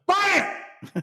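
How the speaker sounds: hammed-up trader yelling buy it; shouted push. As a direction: bark the order to buy. Trader pushing the PM.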